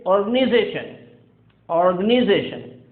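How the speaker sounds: organization with a short "ih", organ-ih-zation; American organization; American pronunciation. Shown as a demonstration. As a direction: say organization as or-gan-ih-zay-shun. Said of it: "will be pronounced as".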